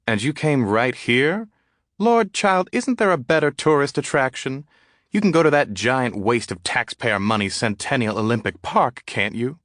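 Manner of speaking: gossipy Southern dandy, teasing; Southern drawl, hammed-up and high. A narrator's reading. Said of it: "in a southern drawl".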